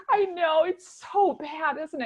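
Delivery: hammed-up tearful agreement, stretched out; ugly-cry laughing release. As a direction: wail it with laughter breaking in. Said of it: "like, that ugly cry".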